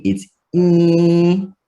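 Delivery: in rising high, sent through the nose; nasal vowel. Shown as a demonstration. Teacher showing the Jamaican nasal vowel, nasal in. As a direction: hum the in into your nose. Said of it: "in your nose".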